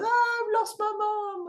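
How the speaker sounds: put-on very high, slow whining child voice; mock crying kid. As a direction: whimper like a lost little kid. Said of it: "You get the kid crying".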